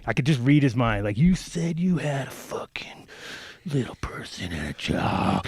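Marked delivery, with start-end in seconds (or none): 1.2-5.4 slow whispered inner voice; slow hushed imagined accusation; whispered thought. Retold or imitated. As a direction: whisper his unspoken grudge slowly. Imagined thought of angry [name].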